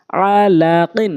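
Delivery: drawn-out syllable by syllable reading; slow demo. Shown as a demonstration. Teacher showing learners the word first, slowly. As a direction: stretch each syllable for learners. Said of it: "প্রথমে আমি slow বলছি".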